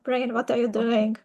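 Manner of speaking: usual speaking voice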